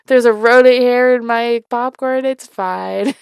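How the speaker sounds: hammed-up breezy unbothered tone; mock nonchalance. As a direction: shrug it off in a silly voice. Mock carefree reaction voice.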